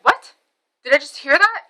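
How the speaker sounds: high, loud, incredulous retort; indignant disbelief. Retold or imitated. Mom hears the insult, high indignant disbelief. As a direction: jump up in pitch on an affronted What.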